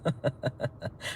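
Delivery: soft, breathy chuckle; low run of chuckles; amused laugh. Real, not acted. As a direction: laugh quietly at a silly mishap. Laughs at viewer falling off bus.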